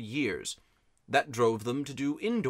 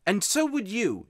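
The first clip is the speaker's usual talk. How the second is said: put-on high-pitched, defiant boy's retort; miserable boy; 8 semitones higher, 4 dB louder than usual. A narrator's reading. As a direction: throw it back as an upset boy. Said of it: "like a boy who is so miserable".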